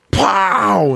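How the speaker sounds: high punchy pow sound effect; mock impact. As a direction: pop it out like a comic punch. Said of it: "all of a sudden it's like".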